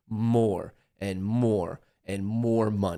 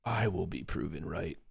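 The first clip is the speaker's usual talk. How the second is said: lower, hushed, half to himself; softer muttered vow; 5 semitones lower, 8 dB quieter than usual. Real, not acted. Streamer vowing to chat he'll be right, hushed. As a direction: swear it quietly to the screen.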